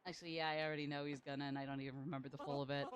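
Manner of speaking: ordinary delivery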